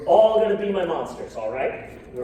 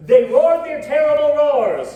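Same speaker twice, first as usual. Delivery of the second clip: louder, higher, roaring it out; mock angry roar; 7 semitones higher, 8 dB louder than usual. Shown as a demonstration. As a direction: growl it like an angry husky. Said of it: "Real, real mad, real angry".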